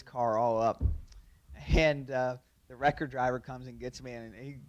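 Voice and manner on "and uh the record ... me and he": ordinary talk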